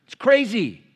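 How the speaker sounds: loud, high preaching push; full-force preaching. Acted. Pastor preaching hard to the congregation, loud. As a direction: drive it home from the pulpit.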